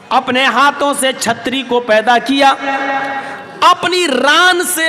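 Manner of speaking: usual speaking voice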